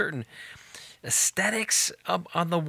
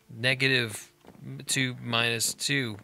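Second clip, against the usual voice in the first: ordinary delivery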